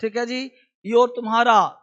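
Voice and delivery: usual speaking voice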